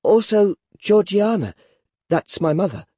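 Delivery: put-on high, timid boy's voice; timid explanation. A narrator's reading. Boy explains his mother's grave to the convict, timid voice. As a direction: explain meekly as a nervous child. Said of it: "I timidly explained".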